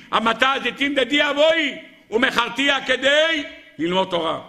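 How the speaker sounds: very high, forceful declamation; ringing high-pitched preacher's cry; impassioned preaching. Acted. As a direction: proclaim each sacrifice with mounting fervour. Preacher declaims rabbi's sold fields.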